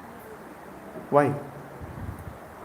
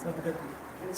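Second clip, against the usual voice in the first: whispered word; 23 dB quieter than usual. Real, not acted. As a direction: whisper Sadgati to yourself, no voice. Trying out the Hindi word.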